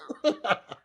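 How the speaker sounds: short high giggle breaking through; quick amused laugh; real laugh. Real, not acted. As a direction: laugh at the absurd letter. Reader laughs between letter lines.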